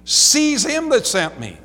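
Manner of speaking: loud, high preacher's proclamation; crying out. Acted. Jesus cries out his final word to unbelievers, loud high proclamation. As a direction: lift up the voice and cry it out to a crowd. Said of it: "he's still crying out".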